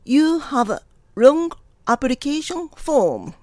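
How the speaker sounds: very slow, phrase by phrase; repeat-after-me model. Shown as a demonstration. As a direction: spell out the sentence for learners in careful chunks. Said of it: "Repeat after me". (区切ってゆっくり発音するお手本)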